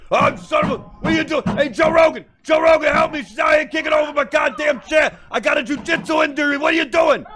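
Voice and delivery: high, shouting, outraged comic rant; Joey Diaz. Retold or imitated. Mocking Joey Diaz knocked to the floor, yelling high for help. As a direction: squeal it out like a furious comic knocked off his chair. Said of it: "If that was Joey Diaz, he'd be fa".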